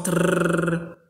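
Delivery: Trrr with a long rolled r after the t; teacher demonstrates the tr cluster; rolled tr sound. Shown as a demonstration. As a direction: hold the trill on Trrr. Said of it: "ele faz esse som".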